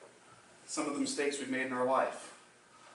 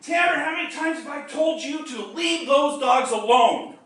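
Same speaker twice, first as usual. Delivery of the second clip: much higher and louder, fed up; exasperated shout; 11 semitones higher, 7 dB louder than usual. Retold or imitated. Grandfather shouting at grandson to leave the dogs alone, loud and high. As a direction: yell it at the kid bothering the dogs.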